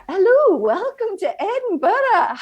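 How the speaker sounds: slow, high-pitched, loud Scottish greeting; Scottish accent. Shown as a demonstration. Interviewee demonstrating accents with a welcome to Edinburgh, Scottish accent. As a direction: put on a big, drawn-out Scottish accent.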